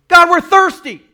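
thirsty people crying to God, high and loud; desperate shout. Retold or imitated.

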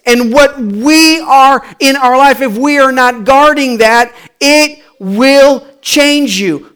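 slow, loud, high, weighty declaration; emphatic preaching. Real, not acted. Preacher warning congregation about influence, slow and forceful. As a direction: drive home each word deliberately.

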